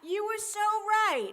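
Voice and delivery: loud, high and slow; excited. Real, not acted. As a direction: burst in thrilled. Bursts in excited after running.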